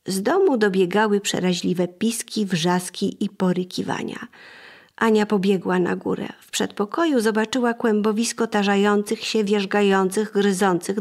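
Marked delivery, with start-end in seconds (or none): none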